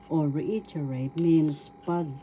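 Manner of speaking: ordinary talk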